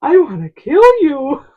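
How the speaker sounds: put-on high, loud little-boy voice saying a cheerful threat; kid's threat. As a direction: play the innocent kid blurting it out. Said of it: "he goes".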